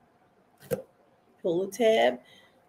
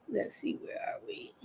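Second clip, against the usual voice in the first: whispered to herself; hushed muttered search; 9 semitones higher, 17 dB quieter than usual. Real, not acted.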